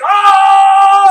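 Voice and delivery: loud, high sustained scream; death scream. Acted. King being killed inside, screaming. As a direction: scream out suddenly as if attacked.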